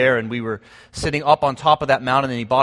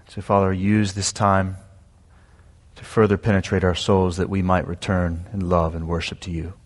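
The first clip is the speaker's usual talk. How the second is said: prayer; 4 semitones lower than usual. Real, not acted.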